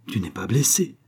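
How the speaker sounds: ordinary delivery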